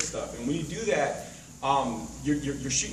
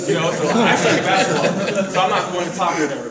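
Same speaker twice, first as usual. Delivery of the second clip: loud mock pity for the quitter; mock sulking loser; 3 semitones higher, 10 dB louder than usual. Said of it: "I'm like".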